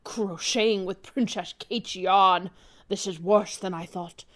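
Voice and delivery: low, slow spy in grave alarm; suave spy. Acted. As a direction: play the cool agent discovering something dire.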